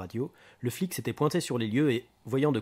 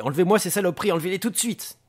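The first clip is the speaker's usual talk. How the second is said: loud, high, frantic command; agitated, urgent outburst; panicked order; 7 semitones higher, 7 dB louder, faster than usual. Acted. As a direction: demand it be removed at once. Booker panics over the dynamite.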